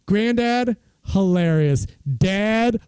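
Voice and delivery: ordinary talk, nothing marked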